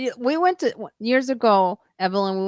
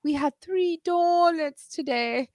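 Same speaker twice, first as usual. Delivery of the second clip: higher, slow, mock-guilty voice; imitating Lois confessing; 9 semitones higher, slower than usual. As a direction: draw it out like a sheepish confession. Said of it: "she goes".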